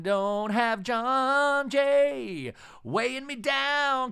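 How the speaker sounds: hammed-up defiant diva voice; mock Shannon rant. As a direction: belt it out like a diva. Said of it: "And Shannon's like".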